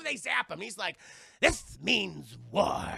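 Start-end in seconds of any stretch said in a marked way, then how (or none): 1.4-2.9 slow, loud, spat-out declaration of war; hammed-up indignant vow; Daffy Duck. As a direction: drag out each word with upset menace. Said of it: "he's very upset about it".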